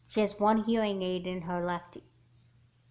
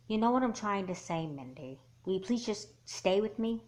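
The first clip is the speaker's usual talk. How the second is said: ordinary talk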